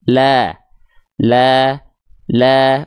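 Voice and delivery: "La" held long with a thin, slightly smiling A; lam tipis. Shown as a demonstration. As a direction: open mouth and slight smile on each "La". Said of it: "Lam tipis". ("La" tipis, sedikit senyum)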